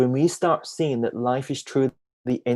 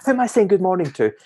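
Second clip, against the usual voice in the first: eager dog voice; 6 semitones higher, 4 dB louder, faster than usual. Retold or imitated. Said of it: "She's like".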